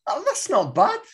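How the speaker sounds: high-pitched, impressed reaction; pleasantly surprised. Real, not acted. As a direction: pitch jumps up in surprised approval. Reacting to the accent attempt.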